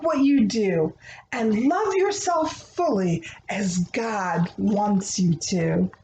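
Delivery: gentle, rhythmic picture-book reading; reading to children. Acted. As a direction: read the rhyming prayer like a bedtime story. Reads a rhyming prayer book aloud.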